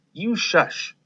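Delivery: ordinary delivery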